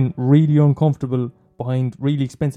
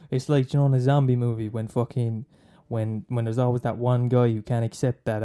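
normal talking voice

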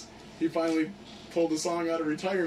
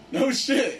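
higher, louder mock disbelief; a quick impressed "no shit", pitched up; surprised; 8 semitones higher, 4 dB louder than usual.

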